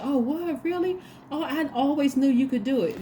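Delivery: high-pitched, exaggerated surprise; mock-amazed caller, voice lifted high; gushing fake caller. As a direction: act thrilled and supportive, laying it on thick. Acts gushing caller on the phone.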